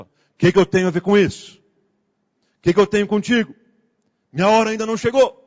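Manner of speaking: loud mock scolding, pitch climbing; imitando pastor ríspido. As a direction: imitate a preacher hurling an indignant rebuke. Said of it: "a gente ouve esse versículo com entonação". (imitação de bronca em voz bem alta, cada vez mais indignada)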